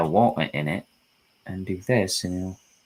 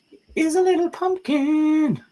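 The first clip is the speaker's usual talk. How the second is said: very high falsetto squeal; silly high voice; 4 dB louder than usual. Pretend. Painter cooing over his little pumpkin, silly high voice. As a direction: coo it in a tiny squeaky voice.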